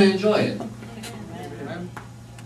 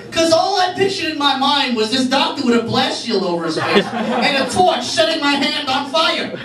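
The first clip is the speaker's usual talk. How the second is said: usual speaking voice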